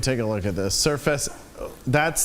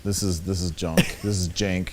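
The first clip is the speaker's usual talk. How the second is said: low, fed-up monotone grumble; disappointed monotone; 6 semitones lower than usual. Pretend. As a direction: sound let down by everything. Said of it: "perpetually disappointed and speak in a monotone voice".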